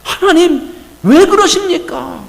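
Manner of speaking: high, loud, slow plea to God; pleading protest. Acted. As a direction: beg God to stop, aghast. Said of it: "모세가 뭐라고 그러냐면".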